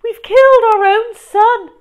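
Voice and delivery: high, loud grieving shriek; hammed-up parental despair. A narrator's reading. Mum imagined mourning her poisoned son, high shriek. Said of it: "Mum would shriek".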